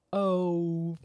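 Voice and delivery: long, drawn-out mock swear; slow-motion curse. Ralphie loses all the nuts, slow-motion curse.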